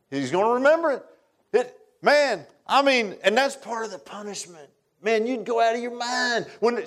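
high, urgent preaching voice; fervent preaching. Acted. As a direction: preach it with rising fire. Preaching at full force on hell.

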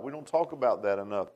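ordinary delivery

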